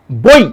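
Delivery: বই said with the ই cut off short, not held; clipped final vowel. Shown as a demonstration. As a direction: stop বই dead on the final ই. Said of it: "বই, ধাক্কা মেরে থেমে যাচ্ছে না".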